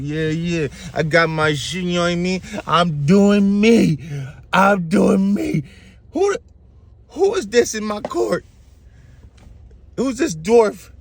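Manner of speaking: high-pitched, drawn-out boasting; hammed-up pompous royal swagger; pompous king. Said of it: "yo, pompous a little bit".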